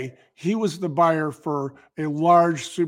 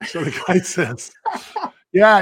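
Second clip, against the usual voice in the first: ordinary delivery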